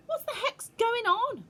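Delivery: put-on high, loud bewildered boy voice; puzzled boy. A narrator's reading. Bruno asking what is happening, high and uneasy. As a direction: ask like an uneasy schoolboy. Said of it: "Bruno asked".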